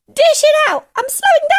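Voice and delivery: very high, screechy holler; frantic old woman shouting orders; urgent yell. A narrator's reading. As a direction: demand it at full force. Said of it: "she yelled".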